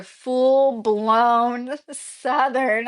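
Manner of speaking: drawn-out Southern twang with a laugh; hammed-up Southern. Shown as a demonstration. Describing locals' accents to viewers, hammed-up Southern. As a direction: stretch it out slowly in playful Southern.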